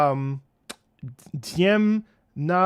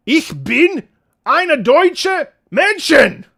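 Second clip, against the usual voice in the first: high, loud, slow German declamation; hammed-up German proclamation, each word belted out; mock German shouting; 9 semitones higher, 10 dB louder, much slower than usual. Streamer suddenly speaking German to chat, mock German shout.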